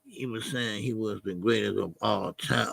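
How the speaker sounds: low, subdued repeat to himself; quiet pondering. Real, not acted. Streamer working out what a chatter meant, quiet pondering. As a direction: mull it over softly in a low voice. Repeating the point before it clicks.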